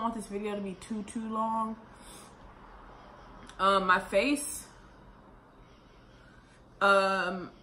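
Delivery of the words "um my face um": deliberately slower; slowed down. Real, not acted. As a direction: ease off the pace. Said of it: "let me slow down".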